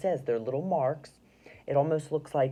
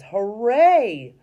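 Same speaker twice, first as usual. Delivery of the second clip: shouted hooray; 6 semitones higher, 11 dB louder than usual. Shown as a demonstration. First reading of Lucy's shout.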